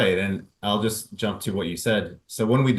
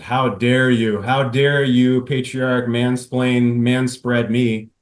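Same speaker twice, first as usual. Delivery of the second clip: slow, louder indignation; mock outraged wife; 3 semitones higher, 8 dB louder, slower than usual. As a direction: drag out each How dare you in fake outrage. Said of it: "She says".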